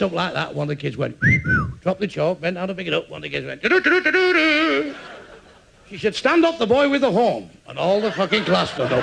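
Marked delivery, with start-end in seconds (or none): 3.6-4.9 high, drawn-out bugle noise; slow, high mock horn call; imitated horn. 6.2-7.5 high, sharp classroom order; acted indignant schoolteacher; teacher's command. Teacher orders culprit to stand.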